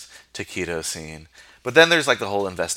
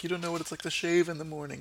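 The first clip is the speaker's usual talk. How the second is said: mock whiny plea; 9 semitones higher, 8 dB quieter, faster than usual. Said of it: "Rob Schneider's like".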